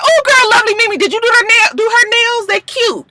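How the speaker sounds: very high, chirpy imitation; gushing interruption. Tammy cutting in about nails, gushing. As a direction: squeal sweetly over someone else's explanation.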